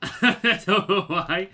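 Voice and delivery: real laugh running into the words; helpless, slowed by laughter. Real, not acted. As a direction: say it through a drawn-out laugh. Laughs at own accent slip.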